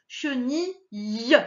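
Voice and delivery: "chenille" slowly, "che-ni", then "yeu"; syllable by syllable. Shown as a demonstration. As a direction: break up "chenille", sounding its ending. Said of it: "derrière, on a le E". (« che-ni… lle » lentement, en détachant la fin)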